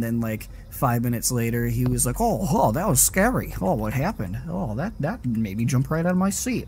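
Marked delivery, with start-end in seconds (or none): 2.2-6.6 high swooping mock-startled voice; exaggerated singsong impression of a friend; mocking imitation. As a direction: put on a goofy, over-the-top scared reaction. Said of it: "he said it in that sort of voice".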